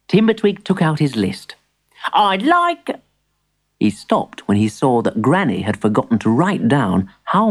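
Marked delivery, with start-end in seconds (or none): 2.1-3.0 put-on high little-boy character voice; storybook child's voice; young grandson. Plays young Timbertwig.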